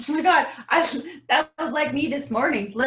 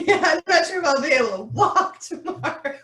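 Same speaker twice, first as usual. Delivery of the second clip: real laughter breaking through the words; louder, giggling self-mockery; laughing; 5 semitones higher, 5 dB louder than usual. Real, not acted. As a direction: laugh at how sore you'll be. Laughing about sore muscles.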